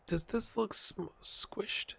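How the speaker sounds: breathy whispered question to himself. Real, not acted. Vlogger squinting at the changed game screen, whispering. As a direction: whisper it like you doubt your own eyes. Puzzling over the squished screen.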